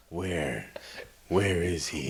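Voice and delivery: low, quiet tough-guy voice; soft-spoken badass. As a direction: growl it low and calm. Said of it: "his tone of voice never changes".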